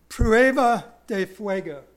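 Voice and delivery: Spanish said like a gringo; careful American-accented Spanish. Shown as a demonstration. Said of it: "I say it like a gringo".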